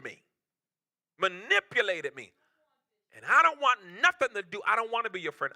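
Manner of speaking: a loud sermon crescendo; full-force preaching. Acted.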